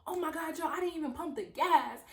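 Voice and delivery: high startled cry; mock alarm. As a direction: suddenly realize the blunder. Mom realizing mistake.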